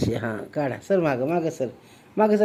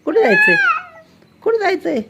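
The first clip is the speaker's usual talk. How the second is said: much higher, sing-song coo to the cat; 4 dB louder, faster than usual. Real, not acted. Mother coos at the cat while filming.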